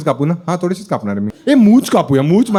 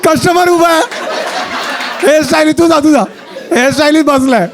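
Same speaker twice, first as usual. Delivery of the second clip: excited call; 10 semitones higher than usual. Real, not acted. Shooing stylist off, customer waiting.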